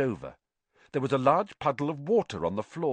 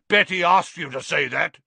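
loud, high jeering voice; mocking ghost knight; 7 semitones higher, 8 dB louder than usual. A narrator's reading. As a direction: taunt him, laughing.

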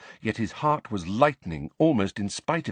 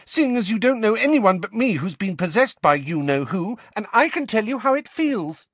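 high, loud, angry tirade; 13 semitones higher, 6 dB louder than usual. A narrator's reading. Ginny reminding Harry she was possessed, angrily. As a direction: drive the point home angrily, loud and high. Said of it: "said Ginny angrily".

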